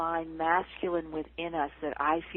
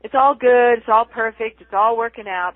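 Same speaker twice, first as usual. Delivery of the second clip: loud, breezy platitude voice; breezy spiritual cliché; 3 semitones higher, 13 dB louder than usual. Retold or imitated. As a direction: toss it off like a cheerful mantra. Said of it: "we say that in spiritual circles".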